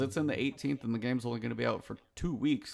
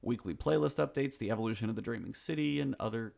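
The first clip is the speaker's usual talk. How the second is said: ordinary talk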